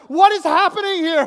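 loud, high astonished shout; hammed-up baffled outburst; bewildered yell. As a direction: cry out in utter disbelief. Bewildered counselor amid crying kids.

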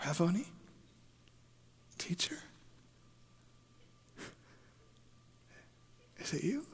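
soft, halting questions with long pauses; stunned, tentative near-whisper; hushed disbelief. Acted. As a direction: ask it barely aloud, not daring to believe. Acting Mary's stunned recognition.

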